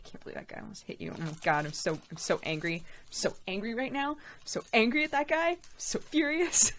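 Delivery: fast, quiet, seething mutter; mock fuming mutter. Walking with Sam afterwards, fast quiet mock fuming. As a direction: rant under the breath, half joking. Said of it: "I was just so angry".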